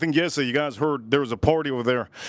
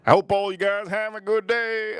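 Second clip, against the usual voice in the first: imitating viral guy; 6 semitones higher than usual. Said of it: "And then he said".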